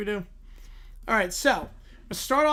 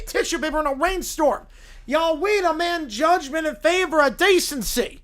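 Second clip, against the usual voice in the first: Southern lawyer; 4 semitones higher than usual. Acted. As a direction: preach moral outrage to the judge like a Southern showman. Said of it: "Southern blue-haired lawyer".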